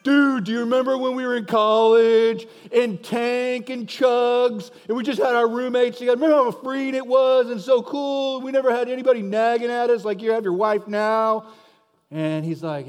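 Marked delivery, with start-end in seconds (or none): 0.0-11.5 loud, high dude-bro reminiscing; hammed-up frat buddy, pitched up; old college buddies. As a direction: hype up the good old days as a college pal. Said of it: "they're all there like".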